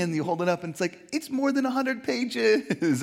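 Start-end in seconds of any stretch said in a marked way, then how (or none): 1.1-3.0 high, slow mock dismay. Holding up the book club book, high, slow mock dismay. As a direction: drag out the horror at the page count.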